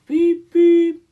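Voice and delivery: very high, loud whistle sound; engine whistle. Acted. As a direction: toot it like a little engine's whistle. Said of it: "he whistled".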